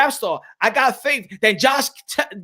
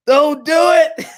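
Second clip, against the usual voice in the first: slow, drawn-out shout, higher and louder; pleading shout; 6 semitones higher, 6 dB louder, much slower than usual. Real, not acted. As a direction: beg them not to do it. Pleading against a bad fight.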